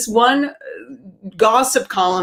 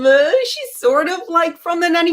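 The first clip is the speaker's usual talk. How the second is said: sheepish high admission; 8 semitones higher than usual. Retold or imitated. Comedian sheepishly admits to the radio host her mom is like The Nanny. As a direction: drag it out, embarrassed.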